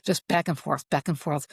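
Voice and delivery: ordinary talk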